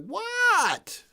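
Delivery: very high, loud bewildered what; shocked what. Retold or imitated. Young streamer confused by dad's shout, high shocked question. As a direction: blurt it out, confused and startled. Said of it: "And I was like".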